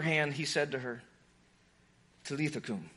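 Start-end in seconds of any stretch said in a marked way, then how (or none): 2.2-2.9 quiet and low, almost hushed; hushed call to wake; soft Aramaic words. Retold or imitated. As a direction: speak it gently to a sleeping child. Jesus speaks to dead girl.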